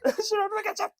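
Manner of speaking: high pitched hammed-up panic; imitating a terrified mate; mock panic. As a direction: squeal it like a scared friend. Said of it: "And Cam's like".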